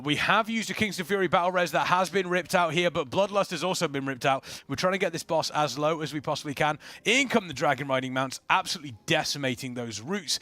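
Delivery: lively esports caster read; play-by-play commentary. Acted. Caster narrates the raid boss pull for viewers, lively play-by-play. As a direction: call the fight like a live caster.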